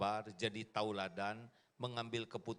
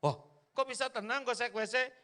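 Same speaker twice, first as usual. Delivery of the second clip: puzzled husband; 12 semitones higher, 5 dB louder than usual. Acted. Husband puzzled, acted quote.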